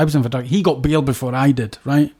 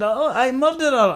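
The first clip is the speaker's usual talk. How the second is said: higher, casual mock Swedish accent; offhand Swedish accent; 9 semitones higher than usual. Cellmate admits murder offhand in mock Swedish accent. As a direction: say it like it's nothing, Swedish. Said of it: "he said".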